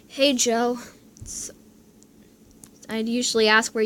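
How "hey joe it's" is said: ordinary delivery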